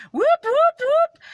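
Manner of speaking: high, loud whooping siren; sudden high-pitched siren whoops; imitated police siren. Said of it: "turns his lights and sirens on".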